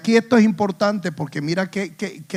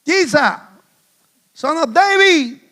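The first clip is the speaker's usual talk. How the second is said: high, raised call for attention; voz alzada; 9 semitones higher, 4 dB louder, slower than usual. Acted. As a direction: call out to Jesus from a distance. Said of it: "alzaron la voz". (llamando a gritos, voz aguda)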